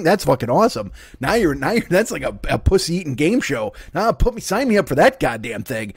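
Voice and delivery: ordinary talk, nothing marked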